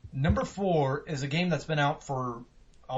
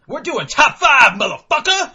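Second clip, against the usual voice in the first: hyped shout; 9 semitones higher, 14 dB louder than usual. Real, not acted. Host echoing his cohost's hype, loud and high. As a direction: echo the call as a hype man.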